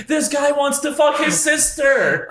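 high, drawn-out taunting holler; mock screaming. Quoting himself on the recording, high slow mock scream. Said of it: "a lot of me screaming".

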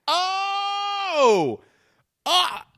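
high, loud exasperated cry; a loud strangled shout of annoyance; outraged shout. Retold or imitated. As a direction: yell out in frustrated disbelief. Outraged at the interruption.

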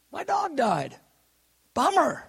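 high-pitched, hammed-up bad news; mock lament. Pastor retelling his dog's death, comic mock lament. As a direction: pitch it up into a mock-mournful bummer.

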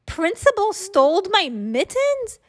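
high-pitched, scandalized question; very shocked. A narrator's reading. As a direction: ask in utter disbelief, voice high. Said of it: "very shocked".

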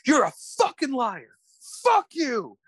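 high furious outburst at a classmate; angry yell. Retold or imitated. Kid yelling at classmate in class, high angry outburst.

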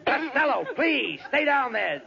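normal talking voice

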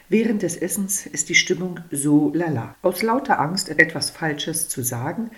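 ordinary delivery, nothing marked

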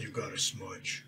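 slow, hushed naming of spell; spell name, said softly. Acted. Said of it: "it's called".

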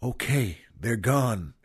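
soft, hushed retelling of a whisper; retold whisper. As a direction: say it low and hushed, like to a body on the floor. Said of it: "and whispers to him".

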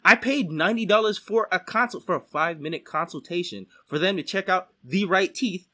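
normal talking voice

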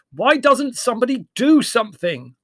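high, loud call over the uproar. A narrator's reading. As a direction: shout over the barking dogs. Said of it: "raising his voice above the uproar".

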